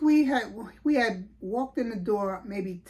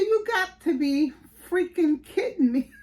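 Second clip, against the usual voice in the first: laughing through it, higher; amazed laugh at the surprise news; laughing disbelief; 7 semitones higher, 3 dB louder than usual. Real, not acted. Hearing baby born unexpectedly.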